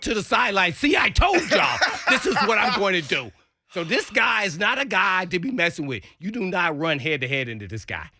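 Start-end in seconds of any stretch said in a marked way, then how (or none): 0.7-3.3 retold boast yell. Player yelling to his sideline after the hit, laughing.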